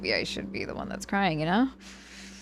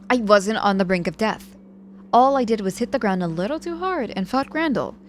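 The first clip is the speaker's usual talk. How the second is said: louder, higher, correcting sharply; indignant denial; 4 semitones higher, 7 dB louder than usual. Acted. Patient denying she nearly died, loud indignant correction. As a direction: flatly reject the drama. Rebutting exaggeration.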